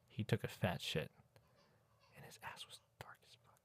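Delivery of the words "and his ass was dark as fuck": whisper. Pretend. Whispered punchline of joke.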